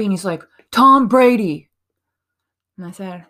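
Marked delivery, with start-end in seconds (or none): 0.7-1.7 grudging, louder answer; grumpy. Retold or imitated. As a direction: mutter the name like a sulky teen. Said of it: "looks at me all kind of grumpy".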